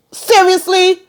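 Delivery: high, disbelieving squeak; incredulous seriously. Real, not acted. Fed up with the show, asking in high disbelief. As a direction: ask it in sheer disbelief. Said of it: "this pissed me off so much".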